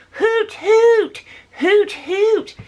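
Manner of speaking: high, loud clipped hoot hoot; mock neighbor's hoot. Imitating neighbor's owl call heard in the yard. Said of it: "he was not calling out in the owl sound".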